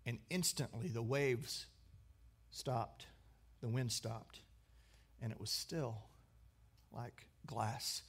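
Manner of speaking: soft, low, with pauses between phrases; hushed awe. A narrator's reading. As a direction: let it settle into stillness, quietly. Preacher depicts the storm stilled.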